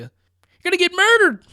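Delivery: very high, loud shriek; over-the-top doom warning; mock alarmist warning. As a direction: holler it like a hysterical naysayer. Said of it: "which everybody w-, will tell you".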